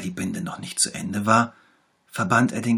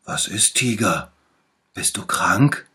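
lightly voiced little bear, louder and caring; 7 dB louder than usual. A narrator's reading. Little bear runs to the fallen tiger, louder and concerned. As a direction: call out like a worried little friend. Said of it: "Sofort kam der kleine Bär gelaufen und rief".

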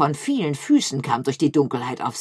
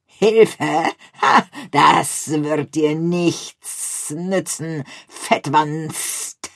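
slow, much louder mocking growl; growling rat; 6 dB louder, much slower than usual. A narrator's reading. A second harbour rat growls a threat at Picandou. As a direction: growl the taunt right into his ear. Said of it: "knurrte eine zweite Stimme".

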